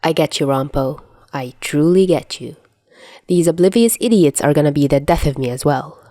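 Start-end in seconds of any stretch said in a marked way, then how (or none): none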